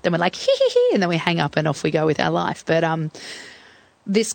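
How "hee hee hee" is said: high-pitched imitated giggle; mock prank giggle. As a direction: titter like a kid on a prank call. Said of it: "pretended to be someone else".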